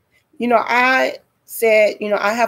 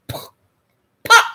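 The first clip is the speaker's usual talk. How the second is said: short percussive pops, second one louder; imitated popping; 4 dB louder than usual. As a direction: pop the lips sharply, twice.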